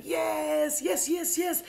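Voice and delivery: high, loud gushing cheers; celebrating crowd. Retold or imitated. Whole bar celebrates the woman buying drinks, cheering. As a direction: cheer like an adoring drunk crowd. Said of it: "the whole bar celebrated me".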